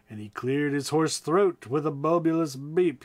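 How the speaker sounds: ordinary delivery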